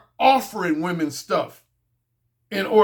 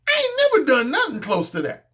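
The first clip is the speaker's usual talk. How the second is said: normal talking voice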